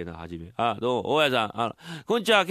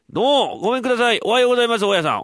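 high, loud greeting at the door; loud cheery visitor; 8 semitones higher, 7 dB louder, faster than usual. Acted. 大家の家を訪ねたてっつぁんが、大声で明るく挨拶. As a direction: announce yourself brightly at the gate.

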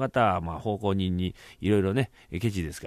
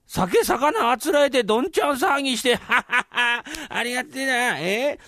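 high-pitched, laughing reveler; carousing servant; 12 semitones higher, 3 dB louder than usual. Acted. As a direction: revel at full merriment, laughing through it.

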